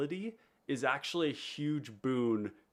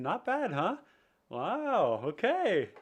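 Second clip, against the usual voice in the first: excited; 9 semitones higher, 4 dB louder, much slower than usual. Real, not acted. Reacting to new green screen.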